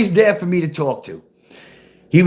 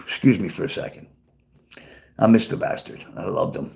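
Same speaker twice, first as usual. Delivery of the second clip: sad; 10 semitones lower, 7 dB quieter than usual. Real, not acted. Missing his late dad.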